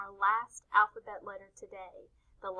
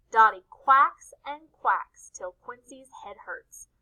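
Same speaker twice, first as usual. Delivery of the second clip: ordinary talk, nothing marked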